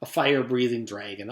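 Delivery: ordinary delivery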